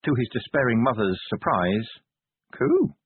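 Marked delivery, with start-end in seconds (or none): 2.5-2.9 put-on very high baby coo. A narrator's reading. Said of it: "and said".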